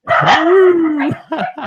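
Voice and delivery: bursting into a big high laugh; cracking up at his own joke; loud laugh. Real, not acted.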